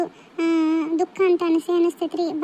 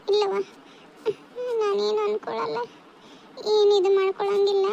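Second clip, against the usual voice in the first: acted crying; 3 semitones higher than usual. Mother reassuring her daughter at parting, crying. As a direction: reassure her through held-back sobs. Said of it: "ಯಾಕ್ ಅಳ್ತೀರಿ ಅಂತ".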